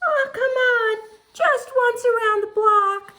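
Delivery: high, loud wheedling pigeon voice; pleading pigeon. Acted. Pigeon trying to convince us to give in, high pleading. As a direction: beg in a high squawk. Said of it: "he's trying to convince us".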